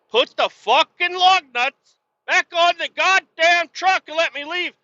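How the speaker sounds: high, loud furious yell; retold yell. Yelling at the shop boss to give back his truck, furious. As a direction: blow up at the boss like it's happening again.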